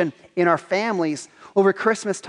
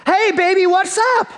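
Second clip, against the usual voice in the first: put-on even higher, loud hey baby, pressing harder; pushier greeting; 11 semitones higher, 4 dB louder than usual. Briar Rabbit trying the tar baby again, higher insistent call.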